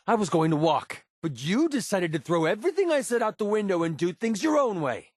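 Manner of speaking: high, heated tirade; angry accusation. Acted. Accusing a partner of ignoring warnings, heated and high. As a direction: lay the blame, voice climbing high in anger.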